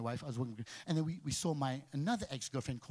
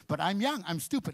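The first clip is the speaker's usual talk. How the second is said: ordinary delivery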